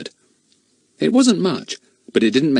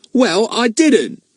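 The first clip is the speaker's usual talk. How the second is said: high, slow, louder huffy denial; protest; 14 semitones higher, 5 dB louder, slower than usual. Acted. Ern denies giving his uncle the chance, high and huffy. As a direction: dig in, offended.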